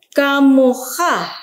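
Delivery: Kamoha said slowly and loud; over enunciating. Shown as a demonstration. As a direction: drag out Kamoha syllable by syllable. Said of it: "teacher models word".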